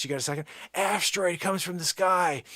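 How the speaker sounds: usual speaking voice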